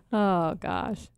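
ordinary talk

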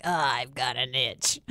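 low, slow actor impression; Daniel Craig. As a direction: drag it out low and slow, imitating a film hero. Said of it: "I love Daniel Craig in that".

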